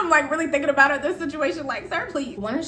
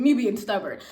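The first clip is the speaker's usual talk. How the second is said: usual speaking voice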